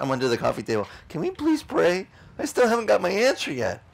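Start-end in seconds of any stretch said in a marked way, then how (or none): 1.1-3.8 whiny plea. Retold or imitated. Pleading to resume prayer.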